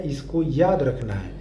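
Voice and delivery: usual speaking voice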